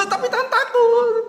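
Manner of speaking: very high mock-crying child; anak menangis ketakutan. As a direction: whine in fear like a small child. Acting the crying child's protest. (rengekan anak ketakutan)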